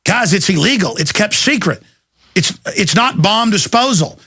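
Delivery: ordinary talk, nothing marked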